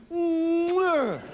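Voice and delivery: loud mock air-kiss smack; a big loud fake kiss into the air; hammed-up air kiss. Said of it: "They just did it, go".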